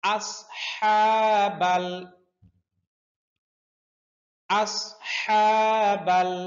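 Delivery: slow আসহাবাল with a heavy স and a pressed throat হা; Quran recitation demo. Shown as a demonstration. Teacher showing students the heavy সদ in আসহাবাল. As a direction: draw out আসহাবাল, the হা squeezed gently from mid-throat. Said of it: "এখানে সদ মোটা করে পড়তে হবে".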